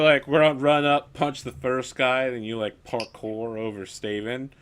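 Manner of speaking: ordinary delivery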